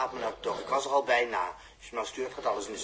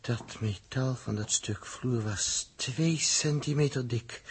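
usual speaking voice

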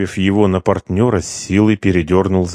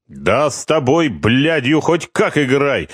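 heated; 7 semitones higher than usual. A narrator's reading. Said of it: "горячился Харитонов".

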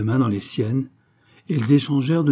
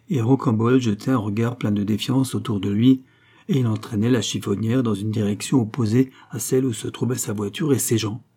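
ordinary delivery

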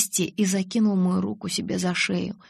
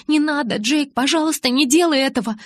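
higher, louder frantic begging; desperate pleading; 7 semitones higher, 6 dB louder than usual. Acted. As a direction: plead in panic. Bella begs Jacob not to die.

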